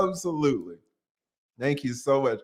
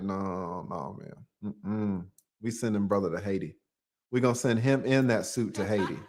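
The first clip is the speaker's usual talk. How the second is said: delegation elder voice; 8 semitones lower, 6 dB quieter than usual. Retold or imitated. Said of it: "you got the African delegation".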